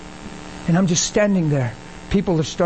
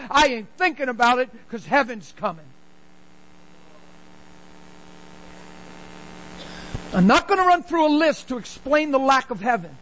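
raised, high-pitched sermon delivery driving each line home; fiery preaching; 7 semitones higher than usual. Acted. Preacher urging the church that heaven comes, preaching hard.

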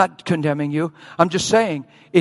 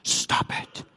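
hushed firm command; 9 dB quieter than usual. Retold or imitated. Calming the frightened mother.

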